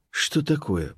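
soft, puzzled murmur; muttered. A narrator's reading. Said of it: "пробормотал он".

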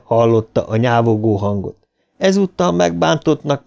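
plain reading voice

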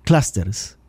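Clusters with a short a, "CLAS-ters"; correct English pronunciation. Shown as a demonstration. Commentator finishing the correction, Clusters pronounced "CLAS-ters". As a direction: say Clusters as one careful word, "CLAS-ters". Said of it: "Es una A corta".

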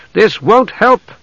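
high, slow, strained call; drawn-out urgent appeal; straining plea. A narrator's reading. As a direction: urge it while struggling to hold him. Restraining a man in a struggle.

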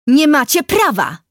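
loud, high, slow protest; outraged girl yelling; angry shout. A narrator's reading. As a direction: shout it indignantly, stretching the words.

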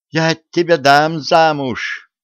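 outraged. A narrator's reading. Widow furious at the suggestion.

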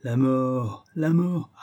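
high sing-out sales pitch; market-seller patter, pitched high; street hawker. A narrator's reading. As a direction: cry your wares like a street peddler. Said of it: "sous le ton d'un camelot".

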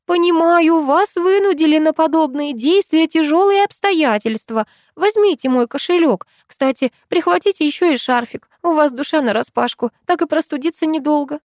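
high, solicitous voice of a kind friend; caring exclamation. Retold or imitated. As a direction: fuss kindly over the robber. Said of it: "заботливо воскликнет".

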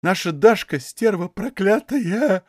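slow, tearful and outraged; indignant tears. A narrator's reading. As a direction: choke out the curse through angry tears. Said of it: "В голосе Дуняшки послышались негодующие слёзы".

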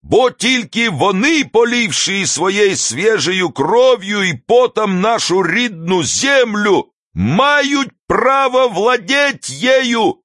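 high-pitched, slow oratory with drawn-out words; stretched, ringing public speech; impassioned street orator. A narrator's reading. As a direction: harangue the crowd at a high pitch.

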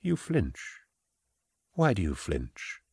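hushed, probing menace; quiet voice of a controlling official; soft-spoken prefect. Acted. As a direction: question them very softly. Prefect questions her softly.

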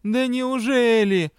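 slow, high, drawn-out sarcasm; sarcastic. A narrator's reading. Partner scoffs at her weak excuse, openly sarcastic. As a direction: stretch it out in mock surprise. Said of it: "сарказм был налицо".

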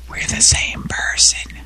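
secret whisper. Real, not acted. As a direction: whisper it like a confession. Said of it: "Why am I whispering?".

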